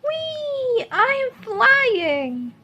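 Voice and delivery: very high, thrilled whee; a child pretending to fly in the wind; gleeful cry. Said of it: "cried Mo".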